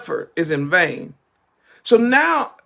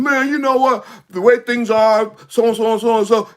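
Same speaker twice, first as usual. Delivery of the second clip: loud, lively crowd chatter imitation; 3 semitones higher, 5 dB louder than usual. Acting out people talking in a crowd, loud and free. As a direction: talk it up loudly, mock griping. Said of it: "your voice go up".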